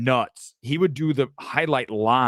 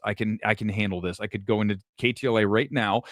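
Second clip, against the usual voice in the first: normal talking voice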